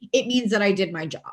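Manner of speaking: ordinary talk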